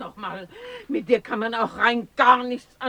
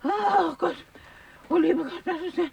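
higher, moaning cries for help; distressed old woman; 8 semitones higher, faster than usual. Acted. Oma stuck in the pit, calling in distress. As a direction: groan it out from the bottom of the pit.

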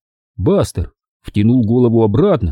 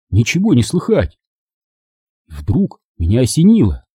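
plain reading voice

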